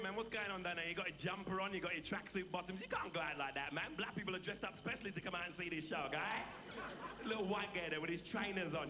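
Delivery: streetwise South London patter; chatty, boastful London street character; Delbert Wilkins, cocky Brixton lad. Acted. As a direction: play a swaggering Brixton wide boy.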